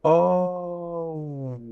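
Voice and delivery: O stretched slowly from oh to oo; drawn-out O. Shown as a demonstration. Teacher demonstrating the diphthong in O, slowly. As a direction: let O glide in two parts. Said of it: "Let's go to the letter O".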